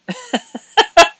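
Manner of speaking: very high, loud squeal of laughter; high squeaky laugh. Real, not acted. Laughing on stream, high squeal.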